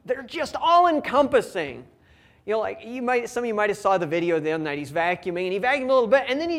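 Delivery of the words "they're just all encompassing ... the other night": usual speaking voice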